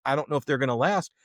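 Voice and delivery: normal talking voice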